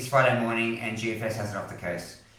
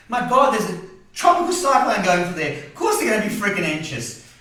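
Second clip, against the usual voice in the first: higher and louder, real worry pouring out; anxious outburst; 8 semitones higher, 6 dB louder than usual. Real, not acted. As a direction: shout out your fear for family. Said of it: "I'm anxious from down here".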